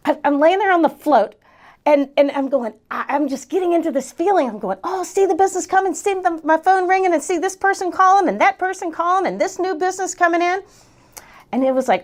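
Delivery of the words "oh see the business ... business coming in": high, sustained eager chant-like list; excited visualizing. Acted. Coach picturing new business from her pool float, excited. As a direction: keep it lifted as each vision arrives. Acting out picturing calls coming in.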